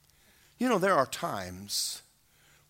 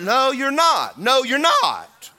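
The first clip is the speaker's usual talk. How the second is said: firm refusal; 7 semitones higher, 6 dB louder, slower than usual. Acted. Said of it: "God answering".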